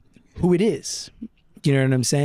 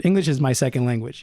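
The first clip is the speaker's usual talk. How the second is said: ordinary talk, nothing marked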